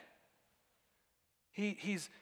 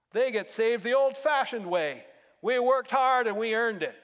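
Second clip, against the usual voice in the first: mock boast; 5 semitones higher, 8 dB louder than usual. Mocking self-made believers' boast.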